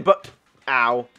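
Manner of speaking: ordinary delivery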